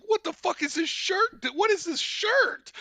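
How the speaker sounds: high-pitched retold disbelief; incredulous question. Brother laughing in disbelief, retold.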